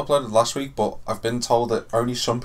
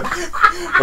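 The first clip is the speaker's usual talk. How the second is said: ordinary talk, nothing marked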